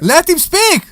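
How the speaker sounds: high, loud protest; indignant shout. Real, not acted. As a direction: yell it in mock outrage. Protesting host speaking for Brian.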